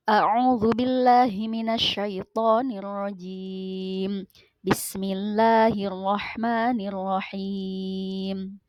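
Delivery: slow, melodic tilawah chant with drawn-out vowels; Quran recitation. Acted. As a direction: recite the verse slowly like tilawah. Teacher recites Quran verse. (lantunan tilawah Al-Quran yang lambat)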